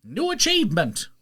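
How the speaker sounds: high, loud hammed-up boast; mock announcer. Boasting he narrates for Audible, high loud announcer. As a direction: brag like a game pop-up. Bragging in game voice.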